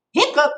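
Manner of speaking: high squeaky hiccup; hiccup sound. Acted. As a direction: pop out a sudden hiccup. Pete hiccups in story.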